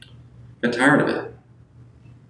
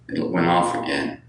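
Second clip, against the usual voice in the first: usual speaking voice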